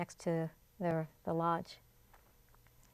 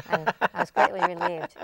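laughing with relief, pitch jumping up; 13 semitones higher, 9 dB louder than usual. Real, not acted. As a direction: burst out laughing as you say it. Relief after the hummingbird scare.

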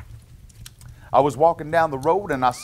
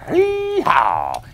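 donkey bray; 5 semitones higher, 5 dB louder than usual. Acted. Reader voices the donkey's bray.

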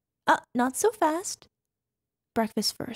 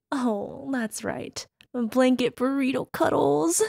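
normal talking voice

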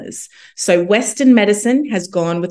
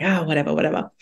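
dismissive brush-off; 2 semitones lower, 5 dB quieter, faster than usual. Retold or imitated. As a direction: toss it off as a retold brush-off. Brushing off the lecturer's suggestion.

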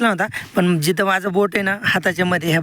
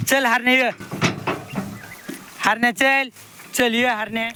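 raised high call to an animal; coaxing call; 6 semitones higher than usual. Real, not acted. Farmer coaxes nervous calf tied to the cart, raised call. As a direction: urge the calf to keep walking.

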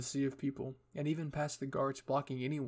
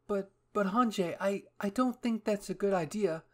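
high, stammering worried protest; alarmed exclaiming; 7 semitones higher, 7 dB louder than usual. Acted. Petra objecting to Hanji's plan, high alarmed stammer. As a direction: object nervously, tripping over the first words. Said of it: "Petra exclaimed".

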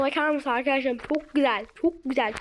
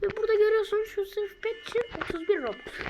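ordinary delivery

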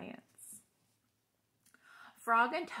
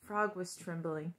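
plain reading voice

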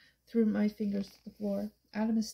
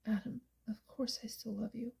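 hushed, breath-only confession; tender whisper; 8 dB quieter than usual. A narrator's reading. Woman reassures her ex of her love, whispering. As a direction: whisper it softly to a loved one.

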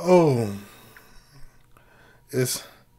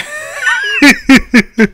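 high burst of laughter; 12 semitones higher than usual. Real, not acted.

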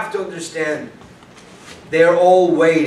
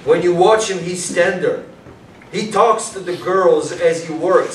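ordinary talk, nothing marked

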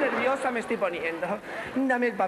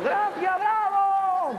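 high, drawn-out shout; calling out; 9 semitones higher, 3 dB louder, much slower than usual. Acted. Star greeting the amphitheatre from a helicopter, high calling. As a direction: call up over a helicopter's noise.

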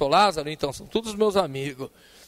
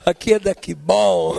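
company caller; 2 semitones higher, 9 dB louder than usual. Retold or imitated.

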